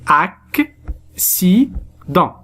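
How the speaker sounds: "ac, si, dent" with a gap after each syllable. Shown as a demonstration. Teacher showing how to read the word, "ac, si, dent" split into syllables. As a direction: say "ac, si, dent" slowly as separate syllables. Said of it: "pour lire ce mot, on dit".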